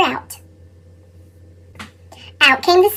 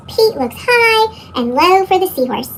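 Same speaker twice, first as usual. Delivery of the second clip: plain reading voice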